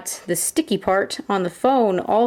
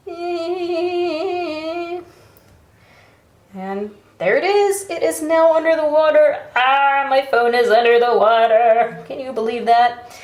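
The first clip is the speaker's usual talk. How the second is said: excited; 6 semitones higher than usual. Real, not acted. Phone now fully submerged.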